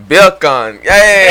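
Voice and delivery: Beer can shouted high and loud like Jamaican "bredren"; loud Jamaican toast, Beer can then Hey!; Jamaican accent. Shown as a demonstration. After the test, he bursts into a loud Jamaican shout. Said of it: "He just made you speak Jamaican".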